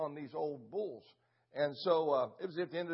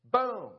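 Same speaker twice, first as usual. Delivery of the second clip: gunshot boom; 6 semitones higher, 6 dB louder than usual. Retold or imitated. Imitating his rifle shot.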